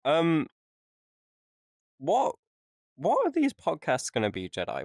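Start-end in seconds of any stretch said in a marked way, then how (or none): none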